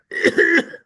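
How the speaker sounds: sudden sharp cough. Real, not acted. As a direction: cough mid-sentence. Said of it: "I'm gonna cough all the time".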